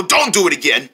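normal talking voice

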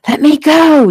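put-on high, loud child's scream; struggling scream. A narrator's reading. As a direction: scream to be released. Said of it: "Jeffy screamed".

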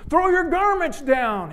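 high, loud and slow, the donkey bossing the crowd; a vain donkey scolding people who ignore him; cross demand. Acted. Donkey snaps at the crowd at the well, high, loud and slow. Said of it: "he said crossly".

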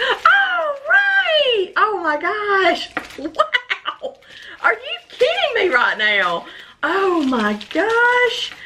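high, stunned exclamations of disbelief; can't believe what she's seeing; overwhelmed surprise. Real, not acted. Collector opening the last pouch, stunned high disbelief.

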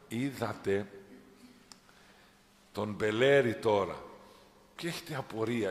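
ordinary talk, nothing marked

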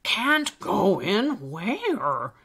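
put-on puzzled old elf. Old elf questions the frightened Alistair, puzzled. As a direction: draw out the baffled question.

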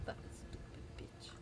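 soft whispered defiance; whispered taunt. Real, not acted. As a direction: whisper the insult under your breath. Defying the game villain.

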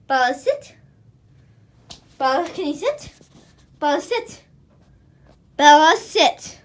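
emphatic command to a distracted dog; insistent command. Real, not acted. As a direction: order the dog firmly, drawing it out. Repeats command to unfocused dog.

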